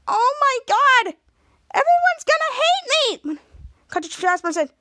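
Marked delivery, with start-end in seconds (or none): 0.1-3.4 high, loud, slow despairing wail; distraught wail. Retold or imitated. Emma despairing that everyone will hate her, high wail. Emma's party ruined, distraught.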